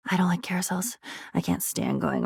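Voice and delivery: sulky whisper; hushed, grudging admission; whispered aside. Acted. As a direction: whisper it like a confession. Sulky aside after mock cheer.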